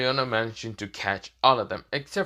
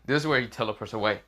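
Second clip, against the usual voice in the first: plain reading voice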